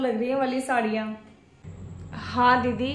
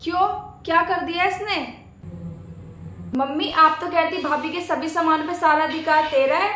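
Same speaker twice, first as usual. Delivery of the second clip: ordinary talk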